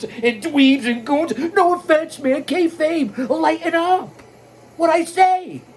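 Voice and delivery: high-pitched playful wrestling-promo taunt; teasing, high-pitched mock tirade; mock heckling. Mock-heckling the viewers, kayfabe.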